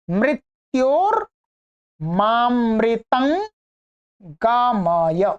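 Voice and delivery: each word of असतो मा सद्गमय set apart with pauses; word by word recitation. Shown as a demonstration. Teacher pronouncing each word separately for learners, careful. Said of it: "अब एक एक शब्द का उच्चारण आप सुनिएगा".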